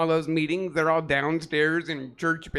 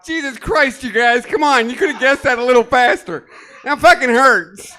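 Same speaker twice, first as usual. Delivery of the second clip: exasperated; 5 semitones higher, 6 dB louder than usual. Acted. Mock pain after hurdle bit.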